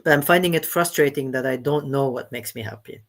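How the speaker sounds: usual speaking voice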